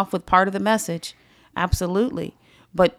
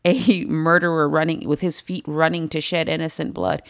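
ordinary talk

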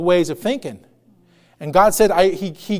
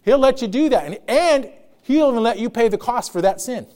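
ordinary talk, nothing marked

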